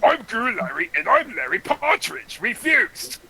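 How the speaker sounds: cartoon character voice. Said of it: "Gary Larry is more like this".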